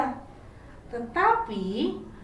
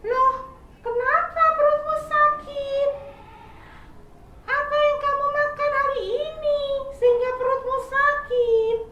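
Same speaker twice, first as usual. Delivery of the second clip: much higher, louder, caring little squirrel; kind squirrel; 4 dB louder than usual. Acted. Squirrel asking crying caterpillar what's wrong, high caring voice. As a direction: sound like a friendly squirrel asking gently.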